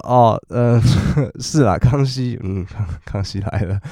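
laughing through the words; can't keep a straight face. Real, not acted. Laughing while retelling reply. (边笑边说)

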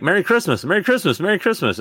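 high, bright singsong well-wishers; cheery greeting voice. Retold or imitated. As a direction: chirp it like a crowd of greeters. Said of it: "people are like".

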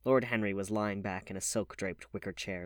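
ordinary delivery

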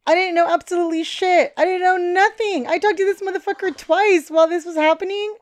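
high, loud protest of knowing nothing; emphatic outburst. Real, not acted. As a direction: pitch it right up and insist loudly. Emphatic answer about being kept in the dark.

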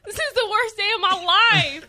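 high, hammed-up lament; mock despair. Retelling the huge bill, mock despairing lament. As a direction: wail it with theatrical misery.

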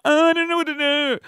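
high-pitched, hammed-up helplessness; mock panic. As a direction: wail it high like a comic fluster. Retold panicked reaction, exaggerated.

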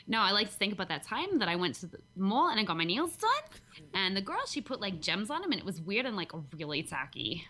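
playful put-on Jersey accent; chatty Jersey girl accent about a salon trip. Shown as a demonstration. Telling about getting nails done at the mall, Jersey accent. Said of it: "my approximation of a Jersey accent".

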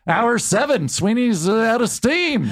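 mock Regis taunting from the crowd; Regis heckling. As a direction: Regis heckling the warm-up comic, high and teasing. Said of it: "He goes".

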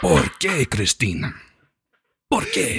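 dramatic Spanish telenovela line, laughing. Shown as a demonstration. To his cohost, demonstrating the telenovela Spanish voice. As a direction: play it like a Spanish soap star. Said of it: "You have to talk like this".

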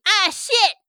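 high, loud curse; panicked little sidekick swearing; high loud minion yelp; Steve's voice. Acted. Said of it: "Steve going".

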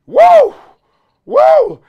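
two very high, loud woos; big high-pitched double "woo"; awestruck whoop. Acted. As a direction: whoop twice as if stunned by the sight. Awed at Waller seen in person.

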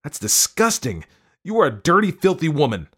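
appalled high-pitched yell; yelled disgust. A narrator's reading. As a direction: spit out your disgust at your wife. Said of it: "Nate yelled".

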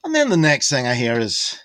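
usual speaking voice

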